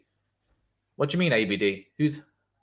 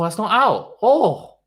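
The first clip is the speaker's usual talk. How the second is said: surprised outcry; 7 semitones higher, 8 dB louder, slower than usual. Real, not acted. Streamer watching the review, startled loud outcry. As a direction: voice jumps high and loud in surprise. Live reaction to umpire review.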